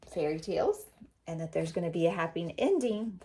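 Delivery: plain reading voice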